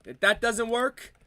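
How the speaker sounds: broad mock Italian-American accent, playing a wiseguy. Comedian riffing on family life in an Italian accent. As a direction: lay on a thick Italian accent like a mob cousin. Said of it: "the Italian accent".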